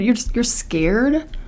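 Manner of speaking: ordinary delivery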